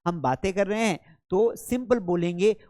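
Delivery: ordinary talk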